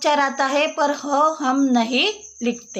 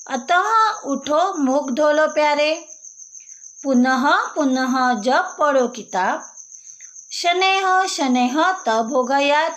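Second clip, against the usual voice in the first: recited rhyme; 3 semitones higher than usual. Shown as a demonstration. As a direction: chant the verse to the class.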